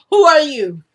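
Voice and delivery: loud, high-pitched indignation; sharp rhetorical challenge; indignant challenge. Real, not acted. Challenges critics of crying on camera.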